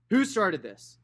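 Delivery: loud, high stern uncle's demand. Acted. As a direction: demand an answer over the noise. Uncle Jack demanding after a fight.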